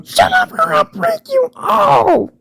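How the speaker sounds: very high, squeaky character voice; high squeaky threat, then a squeaky cry; squeaky cartoon voice. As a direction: squeak it out like a tiny cartoon bully.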